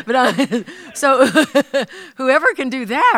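high, loud, laughing through the words. Real, not acted. Host amused by the mystery gift, real laughing. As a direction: laugh while trying to go on.

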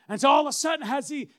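ordinary talk

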